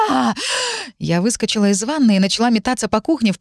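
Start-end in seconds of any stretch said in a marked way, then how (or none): 0.1-0.3 sudden frightened scream; short acted shriek of fright; startled scream. A narrator's reading. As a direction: scream out in shock. Startled by voice in her head.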